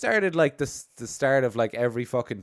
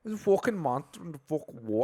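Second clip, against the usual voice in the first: put-on higher, garbled Scottish accent; mock uneducated Scottish villager; old-time Scottish accent; 6 semitones higher than usual. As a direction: garble it in rough old Scots. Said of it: "your Scottish accent".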